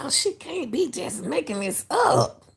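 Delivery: can't believe what she just heard; stunned disbelief. Real, not acted. Reacting in disbelief to psychic clip.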